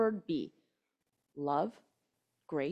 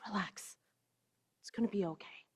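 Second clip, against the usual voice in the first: soothing; 2 semitones lower, 13 dB quieter than usual. Retold or imitated. Telling the anxious heart.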